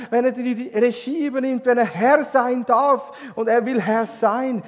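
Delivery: loud, rising preacher's call; full-force preaching. Acted. Preacher promises the congregation freedom, full force. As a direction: preach it out with fire.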